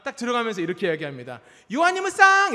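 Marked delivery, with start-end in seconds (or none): 1.7-2.5 put-on high, loud teasing greeting to a baby; playful call to son. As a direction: sing out a silly greeting at the door. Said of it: "이렇게 얘기해요". (높고 크게 장난스러운 인사)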